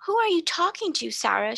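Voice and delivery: high, startled gasp of a question; gasping in alarm. A narrator's reading. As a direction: blurt it out in a fright. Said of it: "she gasped out".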